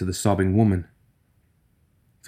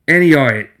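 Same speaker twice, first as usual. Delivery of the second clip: loud, high furious outburst; rage; 6 semitones higher, 6 dB louder than usual. Acted. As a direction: let the rage explode. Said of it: "signaled nothing but rage".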